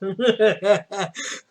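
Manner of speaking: real burst of laughter; laughing. Real, not acted. Laughing at own story.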